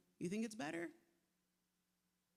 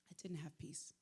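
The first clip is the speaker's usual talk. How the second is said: hushed confession; 3 semitones lower, 7 dB quieter than usual. Real, not acted. Confessing lost peace.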